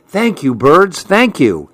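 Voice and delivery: plain reading voice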